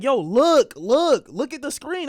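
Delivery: loud, high repeated urging; excited urging. Retold or imitated. Telling his sisters to watch, excitedly insistent. Urges sisters to look at screen.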